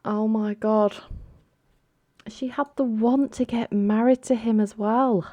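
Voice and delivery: high-pitched, aghast; real disbelief. Real, not acted. Cannot believe the bride left.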